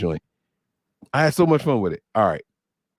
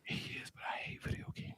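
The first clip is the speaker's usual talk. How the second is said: unvoiced, hushed whisper; whispered confession; 21 dB quieter than usual. Real, not acted. Dad confessing about son's video games, whispering. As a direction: whisper it like a guilty secret.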